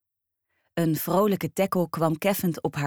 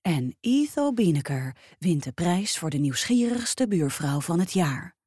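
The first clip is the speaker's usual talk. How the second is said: plain reading voice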